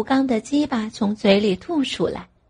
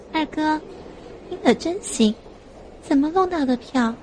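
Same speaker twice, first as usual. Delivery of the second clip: higher voice for the sister; younger sister character; 5 semitones higher, 3 dB quieter than usual. Acted. Sister asks her brother about tickets, higher character voice. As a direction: lift the pitch for a curious sister.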